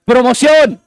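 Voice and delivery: loud, high, rousing shout; forceful shout. Shown as a demonstration. Preacher waking a sleepy congregation, forceful shout. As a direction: belt it out to wake the room. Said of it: "esa palabra fuerte para que se despierten".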